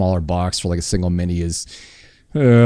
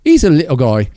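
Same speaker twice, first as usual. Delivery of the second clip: higher, louder put-on voice for Louise's catchphrase; imitating Louise; 7 semitones higher, 6 dB louder than usual. Host imitates his friend Louise's catchphrase, high and loud. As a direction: do Louise saying her pet phrase, higher and louder. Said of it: "As, as Louise would say".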